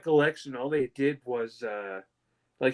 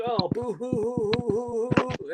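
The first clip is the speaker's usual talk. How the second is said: high, slow hammed-up fake boo-hoo; sarcastic fake weeping, pitched up; mock crying; 9 semitones higher than usual. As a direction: drag out the fake sobs to tease. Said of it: "the fake tears".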